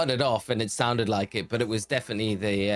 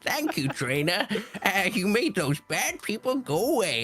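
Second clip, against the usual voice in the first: high laughing mad professor; crazy scientist voice; 12 semitones higher than usual. Acted. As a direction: giggle through it like a crazy scientist. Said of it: "I'm just reading Papa".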